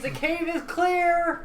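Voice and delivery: very high, slow sing-song; high-pitched call. Acted. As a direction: squeak it out high and drawn-out. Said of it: "what you gotta say is".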